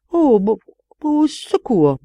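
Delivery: high, halting stammer on the first sound. A narrator's reading. Marquis before the astonishing nose, stammering. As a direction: stumble slowly over the word, pitched high. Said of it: "bégaya le marquis".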